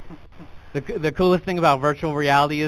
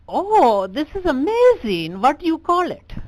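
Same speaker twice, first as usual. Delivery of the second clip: put-on high, amazed clerk voice in an Indian accent; excited bureaucrat; 10 semitones higher, 4 dB louder, slower than usual. Clerk marvels at the new invention, high and excited. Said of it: "how Indian technology was invented".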